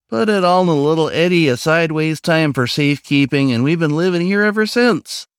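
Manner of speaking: eager raccoon. Acted. Raccoon telling the newcomer about the fair, eager and lightly voiced. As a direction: launch into the old lecture, eager to share.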